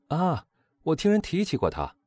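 bright high exclamation of recognition; exclaiming loudly. Acted. Albert at the opera recognizing the countess's name, high exclamation. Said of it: "阿尔贝大声说道".

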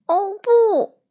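high, loud cry of dismay. A narrator's reading. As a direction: shout it out in alarm. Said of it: "小哥大叫道". (高声惊叫)

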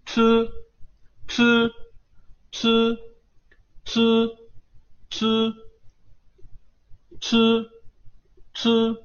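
吃 said as Japanese chi, tongue tip on the gum ridge, quick air through the teeth; Japanese chi demo. Shown as a demonstration. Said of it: "是舌尖抵住上齿龈".

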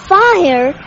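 loud high alarmed cry; calling out fire. Acted. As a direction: yell it out to warn everyone. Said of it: "began calling out".

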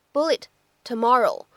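ordinary talk, nothing marked

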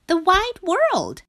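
high-pitched little frog answering; high storybook character voice. A narrator's reading.

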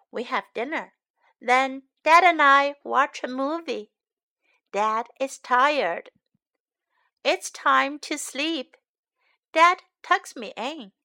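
put-on lively storybook read-aloud for kids; picture book read to young learners; reading to children. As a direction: read it brightly to little listeners.

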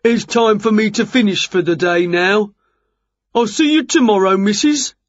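a builder knocking off for the day; builder Jerry, signing off. A narrator's reading.

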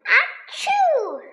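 high, loud mock sneeze; acted sneeze. A narrator's reading. Donnie sneezing while holding the teddy bear, mock sneeze. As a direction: burst out with a squeaky-high "achoo". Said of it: "he sneezes again and again".

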